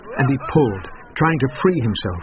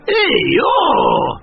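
high loud hee-haw bray; donkey bray; 5 dB louder than usual. A narrator's reading. Donkey braying as the miller lands on him. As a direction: let out a complaining bray. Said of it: "grumbled the donkey".

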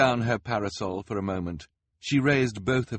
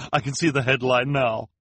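higher, louder, slow gushing headline; higher, louder excited reporter; 9 semitones higher, 6 dB louder, slower than usual. A narrator's reading. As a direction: announce the headline with glee. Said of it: "in excitement".

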